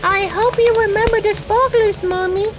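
put-on high-pitched child hoping aloud; little girl. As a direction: ask it like a small girl tugging her mum's sleeve. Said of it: "He heard a little girl asking".

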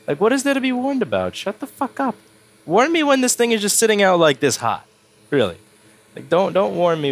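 high, annoyed outburst; exasperated rant. Real, not acted. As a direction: vent at the stupid beeping. Ranting at the beeping iron.

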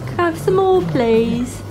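high-pitched plea in a put-on British accent; Oliver Twist. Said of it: "doing the Oliver Twist".

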